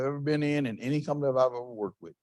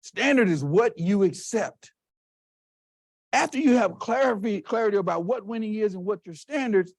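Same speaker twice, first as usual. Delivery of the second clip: ordinary talk, nothing marked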